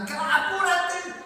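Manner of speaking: high, loud, drawn-out mock shout; imitating an Ecuadorian driver yelling. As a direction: bark Apúrate like an impatient driver. Drivers in Ecuador yell at him.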